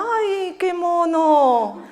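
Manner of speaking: slow, laughing "¡Ay, qué mono!" with a strained, drawn-out qué; covering a dislike with a sugary compliment; knowing, hammed-up politeness; polite fake praise. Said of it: "es que algo del niño no le gustaba".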